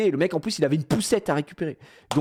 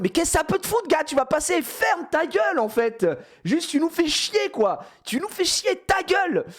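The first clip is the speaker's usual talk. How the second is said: mock telling off; 11 semitones higher than usual. Group telling the yeller to shut up.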